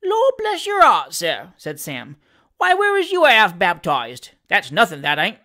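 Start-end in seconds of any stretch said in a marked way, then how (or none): 0.0-1.5 high, loud, drawn-out Cockney exclamation; Sam Weller, indulgent exclamation. Acted. Valet amused at his master's innocence, loudly exclaiming. 2.6-5.4 lively dialect servant reporting with relish; Sam Weller, cheeky manservant. Acted. Valet telling his master of the election, animated Cockney.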